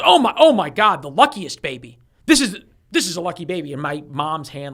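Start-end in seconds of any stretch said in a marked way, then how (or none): 0.0-2.0 higher, louder nurse gushing in amazement; imitating an astonished nurse, loud and high; excited nurse. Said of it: "the nurse goes". 2.2-3.7 fast, eager nurse repeating herself; mock-excited nurse, quick and a little high. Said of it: "the nurse goes".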